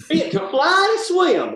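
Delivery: very high, slow Southern drawl on verse; Southern accent. Acted. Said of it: "Your accent".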